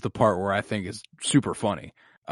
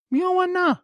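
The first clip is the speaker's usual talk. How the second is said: mock sibling voice; 12 semitones higher than usual. Voicing sibling deciding not to fight.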